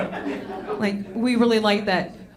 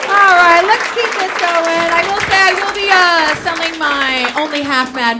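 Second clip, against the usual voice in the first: loud, raised host voice; loud host plug; 7 semitones higher, 8 dB louder than usual. Real, not acted. Comic plugging her merch to the crowd, loud. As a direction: project the announcement to the room. Hosting to audience.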